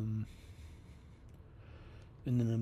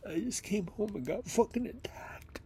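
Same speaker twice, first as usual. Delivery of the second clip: hurt, near tears; 9 semitones higher, 4 dB louder than usual. Real, not acted. Hurt after fight.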